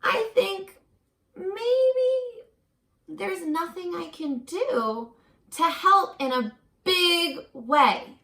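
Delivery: slow gentle storybook reading with a few high lifts; reading to children. A narrator's reading. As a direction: read it to a class of little ones, taking your time. Reading picture book aloud.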